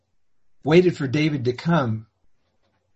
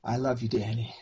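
tender whisper of love; 4 semitones lower, 11 dB quieter than usual. Acted. Father embraces returning son.

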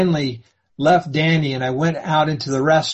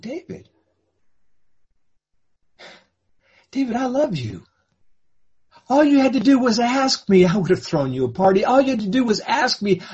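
pleading father; 8 semitones higher than usual. Acted. Father pleading with angry son.

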